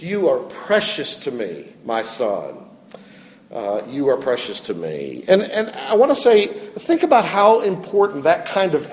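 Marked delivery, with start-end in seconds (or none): 0.0-2.6 tender. Retold or imitated. God's affirmation retold to Daniel. 3.5-5.2 softer tender. Retold or imitated. God's affirmation repeated more softly.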